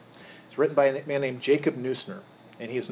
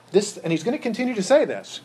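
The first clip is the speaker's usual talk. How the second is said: usual speaking voice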